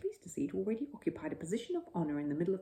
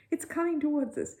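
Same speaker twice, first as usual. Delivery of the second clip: higher, louder alarmed call; alarmed shout; 8 semitones higher, 5 dB louder than usual. A narrator's reading. Mr Brown warns the family the camera approaches, alarmed shout. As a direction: keep the warning going. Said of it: "shouted Mister Brown".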